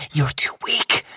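soft voiceless reply; whispered. A narrator's reading. Coco to the narrator, whispered, soft. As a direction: hush him down.

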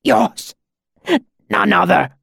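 high, strained gasps of agony; choked out. A narrator's reading. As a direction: squeeze out each word in pain. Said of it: "The words were choked out".

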